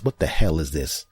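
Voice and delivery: normal talking voice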